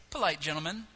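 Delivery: ordinary talk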